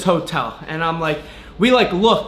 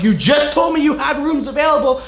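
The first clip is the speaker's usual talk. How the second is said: retold outburst; 6 semitones higher than usual. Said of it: "I'm like".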